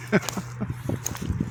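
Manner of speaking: airy helpless giggling fit; cracking up, can't hold it in; breathless giggle. Real, not acted. Telling friends about the climb, breaks into breathless giggles. Nervous laughter retelling climb.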